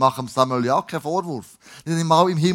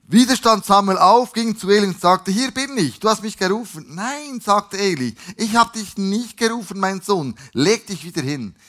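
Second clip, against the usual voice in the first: animated, higher-pitched read-aloud dialogue; scripture storytelling; 4 semitones higher, 6 dB louder than usual. A narrator's reading. Samuel goes to Eli a second time, animated scripture reading. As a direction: play up the repeated exchange between boy and old man.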